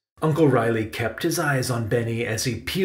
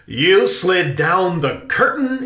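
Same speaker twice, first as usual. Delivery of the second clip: higher, slow, incredulous question; exclaiming; 6 semitones higher, 4 dB louder, slower than usual. A narrator's reading. Uncle hears of the curtain slide, slow incredulous exclamation. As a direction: exclaim it in disbelief. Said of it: "exclaimed Uncle Riley".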